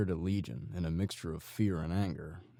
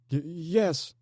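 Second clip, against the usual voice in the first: high, loud broken yes; stammering through tears; 12 semitones higher, 7 dB louder than usual. A narrator's reading. Terrified captive stammering agreement through tears. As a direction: choke out a frightened stammer. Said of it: "the man stammered through tears".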